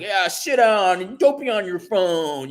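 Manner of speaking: high, put-on old man voice; crabby old guy. Old guy scolding me about my phone, mock old-man voice. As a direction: imitate an old fart telling someone off. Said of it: "this other old guy".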